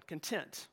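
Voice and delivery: soft "content", stressed con-TENT; "content" said quietly as con-TENT, like the adjective; stress on second syllable. Shown as a demonstration. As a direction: drop the volume and lean on the second syllable of "content". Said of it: "more of that second one".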